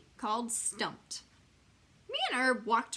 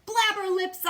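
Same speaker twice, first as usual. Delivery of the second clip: loud, high angry yell; 11 semitones higher, 10 dB louder, slower than usual. Acted. Junie B. yells at May for tattling, loud high yell. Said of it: "I yelled".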